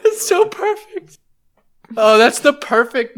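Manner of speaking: high, tearful laughter; laughing to tears, squeaky; after laughing hard. Real, not acted. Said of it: "I'm crying".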